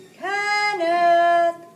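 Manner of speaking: loud, drawn-out call across the pasture. Acted. Mom calling Kenny home across the pasture, loud and drawn out. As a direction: call him home from far off.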